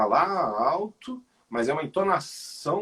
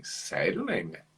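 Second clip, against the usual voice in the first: cético, baixo e sem entusiasmo; descaso; 8 semitones lower, 6 dB quieter than usual. Acted. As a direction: responda com desdém, em tom mais grave. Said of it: "o descaso, o desprezo". (com descaso e desprezo)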